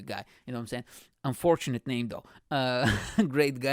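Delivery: ordinary delivery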